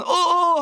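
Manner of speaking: high, loud "uh-uh" of surprise; startled cry. Acted. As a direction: yelp as if caught unawares, playfully. Acting startled while being cleansed. (놀란 척 높고 크게 "어어")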